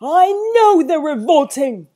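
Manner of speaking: booming bellow, high and loud. A narrator's reading. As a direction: bellow it out in outrage. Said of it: "bellowed Sir Basil".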